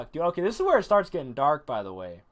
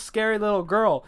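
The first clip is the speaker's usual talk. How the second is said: ordinary delivery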